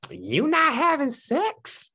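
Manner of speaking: high, slow squeaky friend voice; high little voice. Retold or imitated. Friend quizzing the speaker about her love life, high little voice. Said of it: "She got that high little voice like".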